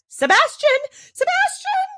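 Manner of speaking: very high, loud shriek of a name. A narrator's reading. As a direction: shriek for the servant in panic. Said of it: "shrieking with all her might".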